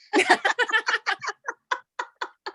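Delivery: long, high-pitched real laugh; laughter. Real, not acted. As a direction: burst out laughing at the joke. Laughs after joking about joining team.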